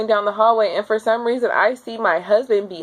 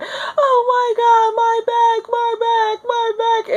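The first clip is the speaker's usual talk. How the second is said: high, slow crying wail; wail of pain; slower than usual. Retold or imitated. In the delivery room, wailing through a contraction.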